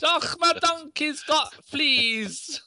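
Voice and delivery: high, stretched-out mock Scottish; bad Scottish accent. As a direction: wail it in a cartoonish Scottish accent. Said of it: "That's my Scottish".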